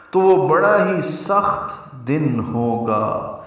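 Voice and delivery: slow, grave reading of the meaning; solemn translation reading. Acted. Teacher solemnly reading the verse meaning to students. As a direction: read the meaning weightily and slowly.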